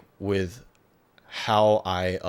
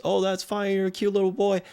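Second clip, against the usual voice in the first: doting shopkeeper; 11 semitones higher than usual. Retold or imitated. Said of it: "what I said was like".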